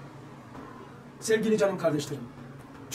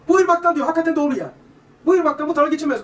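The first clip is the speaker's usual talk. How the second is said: insistent claim; 7 semitones higher, 9 dB louder than usual. Retold or imitated. Villager's claim quoted emphatically.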